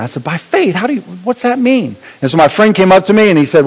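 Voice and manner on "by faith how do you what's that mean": softer, baffled questioning; retold confusion, groping for the sense; puzzled. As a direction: ask it quietly, not understanding. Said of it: "I didn't understand that".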